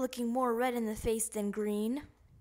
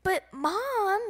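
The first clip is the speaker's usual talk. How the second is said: whined; 8 semitones higher, 5 dB louder than usual. A narrator's reading. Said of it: "whined Froggy".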